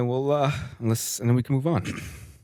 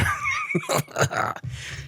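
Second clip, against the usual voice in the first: high squealing laugh; 4 dB quieter than usual. Real, not acted. Reading absurd question.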